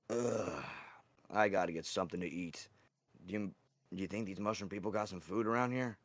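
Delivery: low, subdued character voice; lightly voiced Zoroff. Zoroff asks August about food, lightly voiced low and soft.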